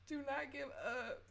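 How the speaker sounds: soft, tearful plea; soft crying plea to listeners. Real, not acted. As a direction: beg them through tears. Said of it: "I've been crying majority of this episode".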